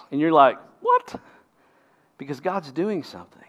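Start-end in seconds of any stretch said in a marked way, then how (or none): 0.8-1.2 sudden high-pitched mock "what?"; baffled disbelief. Preacher voicing a reader baffled by joy in trials, incredulous. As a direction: squeak it in exaggerated bewilderment.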